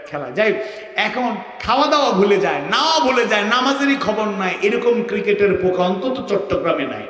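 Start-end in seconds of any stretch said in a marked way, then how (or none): none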